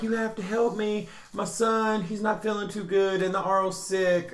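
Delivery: high, lightly voiced frantic plea; pleading girl. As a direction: beg for help as the worried mother. Voicing panicked girl from castle.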